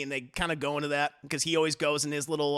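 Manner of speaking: usual speaking voice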